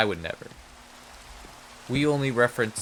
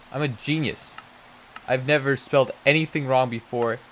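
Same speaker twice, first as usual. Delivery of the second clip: ordinary delivery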